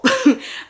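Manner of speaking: a squealing laugh, high and bright; high burst of laughter. Real, not acted. Laughing after calling her dad a fool. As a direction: laugh at her own cheek.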